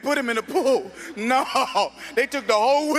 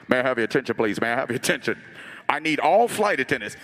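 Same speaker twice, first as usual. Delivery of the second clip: much lower captain's announcement; deep, calm pilot over the PA; pilot intercom voice; 10 semitones lower than usual. Retold or imitated.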